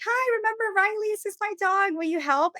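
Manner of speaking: very high, sweet-talking hopeful plea; eager greeting. Retold or imitated. Would-be client reintroduces her dog to the dog walker, eager greeting.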